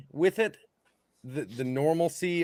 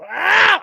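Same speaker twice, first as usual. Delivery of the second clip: short, high, loud mock scream; imitated scream; 12 dB louder than usual. Imitating the woman's scream as she squeezes his hand, loud. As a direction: let out an imitated cry of pain. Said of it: "she, like, gave this".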